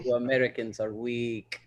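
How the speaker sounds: put-on low, soft Russian accent; quietly scornful Russian accent. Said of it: "like my Russian".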